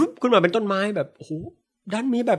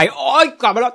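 high, glad welcome; delighted king; 7 semitones higher, 3 dB louder than usual. Retold or imitated. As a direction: greet them with joy. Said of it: "พระราชาดีใจ". (พระราชาดีใจต้อนรับ)

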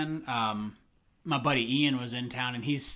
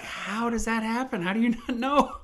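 incredulous; 10 semitones higher, 4 dB louder than usual. Retold or imitated. Retold incredulous reaction to coworker.

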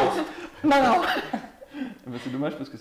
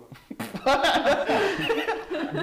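loud laugh; 8 dB louder than usual. Real, not acted. Bursting out laughing.

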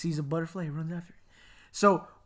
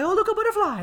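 put-on high-pitched gasp of childlike wonder; mock excited child; 4 dB louder than usual. Brother spots something and wanders off, mock excited. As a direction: pipe up high, easily distracted.

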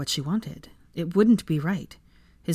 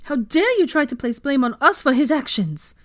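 loud, high, indignant outburst; outraged, raised voice; angry growl; 9 semitones higher, 5 dB louder than usual. A narrator's reading. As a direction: fling the accusation back furiously. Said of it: "she growled at the woman".

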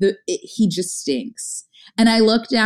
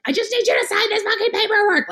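high-pitched, fed-up retelling of an outburst; mock furious squeal at a missed phone call; exasperated outburst; 14 semitones higher, faster than usual. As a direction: vent the anger in a much higher voice. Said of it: "Like, I'm so pissed".

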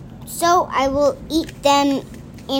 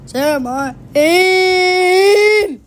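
high, loud, very slow wail on Sam I am; drawn-out wail; 6 semitones higher, 7 dB louder, much slower than usual. Pretend. Child reading to a parent, drawn-out loud wail. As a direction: stretch it out in a loud crying wail.

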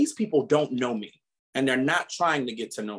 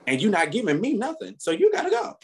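laughing, high dismissive brush-off; dismissive send-off; 10 semitones higher than usual. Retold or imitated. Telling a fake friend to leave, dismissive and amused. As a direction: wave them out of your life with a laugh. Dismissing someone from his life.

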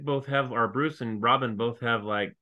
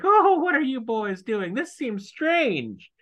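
high, slow puzzled housekeeper voice; fussy high voice; 12 semitones higher, 4 dB louder, slower than usual. Retold or imitated. Harriet catches the boys throwing blades, high and puzzled. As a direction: chirp it like a prim old servant.

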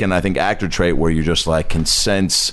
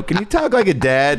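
caller's voice; 9 semitones higher than usual. Retold or imitated. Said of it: "phone sex operator and they go".

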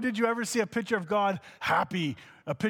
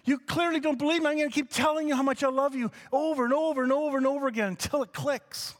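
high, gushing with delight and fast near the end; God overjoyed; 9 semitones higher than usual. Pretend. God rejoicing over the listener, high and thrilled. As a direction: burst with joy over someone you adore. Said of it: "the joy of God going".

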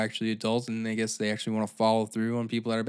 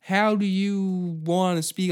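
a higher, slow mock official asking a question; mock court clerk; 7 semitones higher, 4 dB louder, slower than usual. Clerk at the Zoom hearing asking how she'll plead, mock polite. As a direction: play the polite clerk, drawn out and lilting. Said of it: "They'll just be like".

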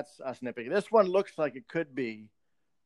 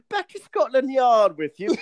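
higher, louder English copper voice, laughing; British accent; 7 semitones higher, 6 dB louder than usual. Pretend. British Batman sending a crook off. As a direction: bark it like a Bobby.

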